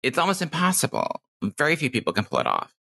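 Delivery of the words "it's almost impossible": it's almost impossible, high and light; higher new voice. Shown as a demonstration. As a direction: lift it up into the new voice. New voice vs old voice.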